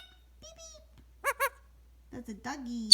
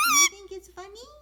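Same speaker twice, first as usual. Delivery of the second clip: loud teasing question; 5 semitones higher, 15 dB louder than usual. Real, not acted.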